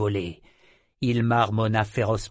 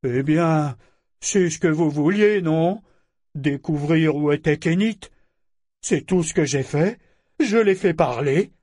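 put-on high, louder, challenging childish voice; nasty defiance; 5 semitones higher, 5 dB louder than usual. A narrator's reading. Figurehead challenging the silent crew, nastily defiant. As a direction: throw rising questions back at them. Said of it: "il prit un air mauvais".

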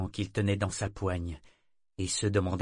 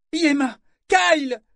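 much higher and louder, slow desperate call; panicked cry; 9 dB louder than usual. A narrator's reading. Vivacia calling Kyle in panic. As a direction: cry out in fear. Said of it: "cria-t-elle".

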